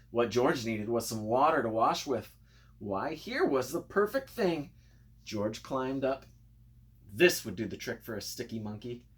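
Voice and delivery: ordinary delivery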